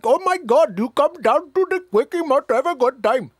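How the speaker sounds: put-on high, loud caricatured Indian accent; mock Indian accent. Host showing a forbidden accent, hammed-up Indian accent. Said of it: "if I do that, that's racist".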